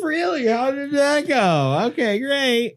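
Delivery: high, slow falsetto wife; mock-surprised falsetto spouse; feigned innocent surprise.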